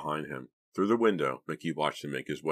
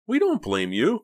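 high, loud placating reassurance; trying to calm; 7 dB louder than usual. A narrator's reading. Frightened boy tries to calm Clay, placating. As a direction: placate him anxiously. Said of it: "trying to calm Clay".

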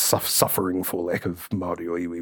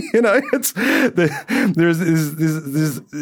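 laughing; 9 semitones higher, 5 dB louder, much slower than usual. Real, not acted. Laughing mid-rant.

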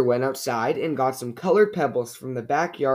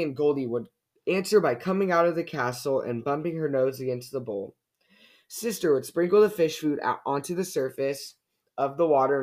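ordinary delivery

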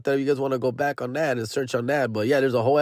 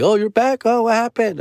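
put-on teenage kids voice; 10 semitones higher than usual. Kids greeting him coming home.

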